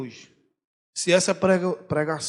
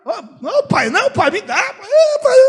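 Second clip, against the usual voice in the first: put-on whining child; 10 dB louder than usual.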